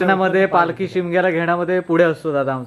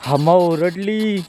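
put-on slow baby talk; slower than usual.

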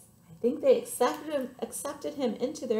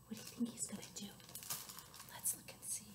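very soft, hushed whisper; suspenseful whisper; 19 dB quieter, much faster than usual. Real, not acted. Storyteller asks children what owl will do, hushed whisper. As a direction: whisper as if keeping a secret.

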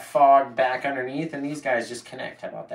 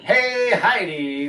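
slow, higher radio-host greeting; 6 semitones higher, 4 dB louder, much slower than usual. Pretend. Painter welcoming a viewer, radio-host voice. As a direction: greet her like a DJ on air. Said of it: "I've got my radio voice on today".

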